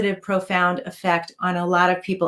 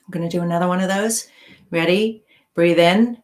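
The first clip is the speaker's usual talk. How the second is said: guided meditation; 4 dB quieter than usual. Acted. Guides breathing and relaxation.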